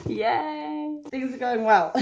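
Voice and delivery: high, loud happy cheer; excited cheer. Real, not acted. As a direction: cheer it with joy.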